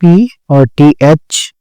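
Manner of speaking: letters spelled out slowly, one by one; teacher spelling out letters. Shown as a demonstration. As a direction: spell पी और TH slowly for the class. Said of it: "फिर है आपका पथ".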